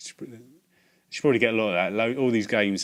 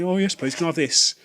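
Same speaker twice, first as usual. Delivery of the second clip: overly polite customer; 7 semitones higher, 6 dB louder than usual. Retold or imitated. His own polite reply.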